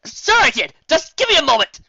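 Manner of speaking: loud, high defensive cry; loud high flustered cry; flustered insistence. Acted. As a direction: cover the mistake. Flustered hero under pressure.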